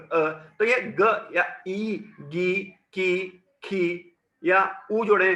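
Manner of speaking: drawn-out "गी, की, खी" sounds; slow syllable demo. Shown as a demonstration. Teacher sounding out vowel signs for the class, slowly. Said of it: "क धन अ".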